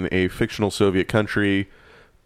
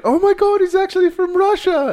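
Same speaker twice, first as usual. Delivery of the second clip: much higher, louder mock-gushing partygoer voice; amazed partygoer; 4 dB louder than usual. Drunk partygoer gushing over the fake Russian in a high mock squeal. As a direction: squeal it like an impressed drunk fan. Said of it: "People were like".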